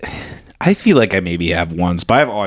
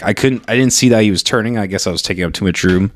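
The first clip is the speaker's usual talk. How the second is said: ordinary delivery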